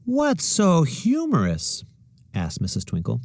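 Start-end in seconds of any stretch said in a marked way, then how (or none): 0.0-1.8 much higher, very slow, dreamy teacher voice; music teacher. A narrator's reading. Music teacher asking the giggling class, dreamy and slow. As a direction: float the question like a dreamy teacher.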